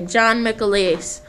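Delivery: normal talking voice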